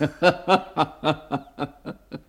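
a short, scoffing laugh at the idea; dismissive laugh. Acted. Inspector scoffing at Holmes's train theory, dismissive laugh. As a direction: laugh off the detective's reasoning.

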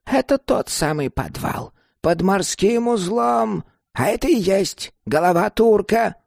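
put-on high old crone voice; scheming old woman. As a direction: explain it pitched well up, as the old woman.

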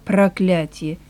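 low, softened mutter; muttered curse. A narrator's reading. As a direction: mutter the curse under your breath. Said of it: "Он пробормотал". (тихо бормоча ругательство)

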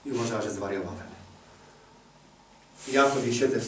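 normal talking voice

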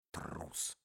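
тихое беззвучное слово; бросить шёпотом с презрением. Acted. Шёпотом у двери. (презрительный шёпот у чужой двери)

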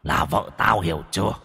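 low, soft menacing ghost voice; dark whisper behind you. A narrator's reading. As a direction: breathe threats from beyond the grave. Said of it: "cái giọng hằn học như từ cõi âm vọng về".

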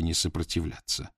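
plain reading voice

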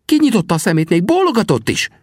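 high, eager protest; excited high-voiced assurance; insistent Sam. A narrator's reading. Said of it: "erősködött a jó szem".

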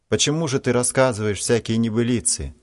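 understated narrated shout of indignation; indignant cry. A narrator's reading. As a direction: challenge him with restrained outrage. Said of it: "закричал от возмущения Ваня".

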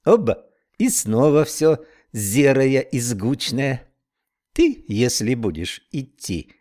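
slow, dreamy Irish brogue; Irish accent. Acted. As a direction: savour it in an Irish lilt. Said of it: "с непередаваемым ирландским прононсом".

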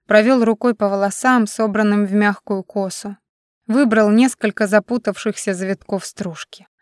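ordinary delivery, nothing marked

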